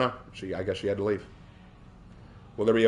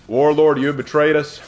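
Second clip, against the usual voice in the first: mock accusation; 4 semitones higher, 6 dB louder than usual. Mock accusation of betraying teammate.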